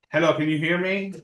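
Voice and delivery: normal talking voice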